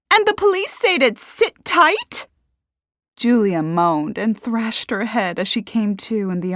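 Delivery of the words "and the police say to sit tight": high, loud incredulous shout; shouted question. A narrator's reading. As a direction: call it out sharply across the room. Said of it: "Parker shouted".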